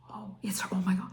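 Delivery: hushed, breathless disbelief; awed whisper. Retold or imitated. Alone in the craft room, recognising Ellen, hushed awe. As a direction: whisper it like you can't believe your eyes. Stunned realisation at the video.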